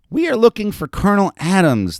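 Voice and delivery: put-on high, loud little-girl announcement; announcing child. A narrator's reading. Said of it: "the child announced to the campfire assembly".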